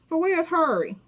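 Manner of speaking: high-voiced mimicry of her accent; Yolanda's accent. Yolanda asking where Harry is, imitated accent. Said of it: "I love that accent".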